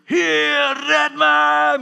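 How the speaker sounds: high, very loud plea for rescue; all-out desperate cry. Acted. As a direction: shout as though drowning. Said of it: "Met alles wat in je". (uit alle macht om redding schreeuwen)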